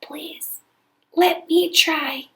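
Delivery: breathy whispered plea. A narrator's reading. Voiceless Cindy whispers to the valet. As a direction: barely voice it, hoarse and soft. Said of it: "pushed out a whisper".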